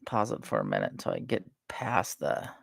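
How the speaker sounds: usual speaking voice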